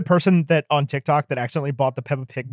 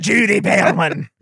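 mock Scottish accent; 3 semitones higher, slower than usual. Host mimicking the co-host's name reading, Scottish accent. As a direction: imitate the name in broad Scottish. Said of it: "Time Magazine is a Scottish production".

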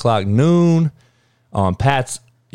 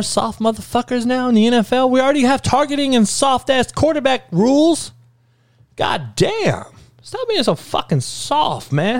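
exasperated rant; 12 semitones higher than usual. Real, not acted. Ranting at soft NFL coaches.

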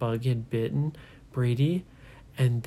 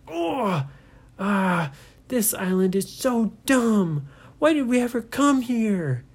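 much higher, louder frustrated yell; angry outburst; 9 semitones higher, 5 dB louder than usual. Acted. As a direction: explode in frustration. Ryan bitten, furious.

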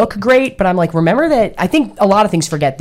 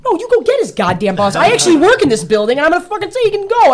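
rising, higher and faster as the anger builds; retold angry rant; 10 semitones higher than usual. Retelling how she demanded the boss at Ford, angry. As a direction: reenact telling them off. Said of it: "And I was like".

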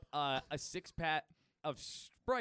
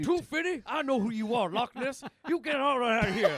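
louder, higher put-on voice, calling out a scammer; hammed-up accusing shout at a con man; suspicious customer; 6 semitones higher, 7 dB louder than usual. Customer calls out the tree-fifty monster, loud mock accusation. Customer spots the Loch Ness scam.

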